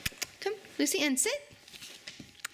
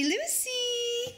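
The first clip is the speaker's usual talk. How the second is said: put-on modelled word for baby; 9 semitones higher than usual. Shown as a demonstration.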